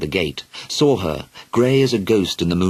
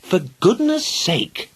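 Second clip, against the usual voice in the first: sharp; 12 semitones higher, slower than usual. Acted. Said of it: "her mother replied sharply".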